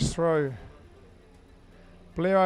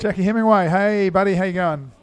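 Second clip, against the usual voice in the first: much louder, hearty shout-out to a viewer; loud greeting; 2 semitones higher, 9 dB louder than usual. Real, not acted. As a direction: boom the name out like welcoming a mate. Repeating viewer name, greeting.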